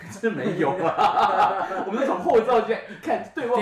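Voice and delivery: laughing. Real, not acted. Laughing while telling story.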